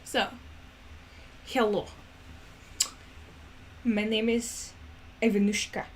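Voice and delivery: lightly attempted Russian accent, halting introduction. Introducing Ivanushka while figuring out her accent, tentative Russian accent. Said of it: "I have to figure out the accent".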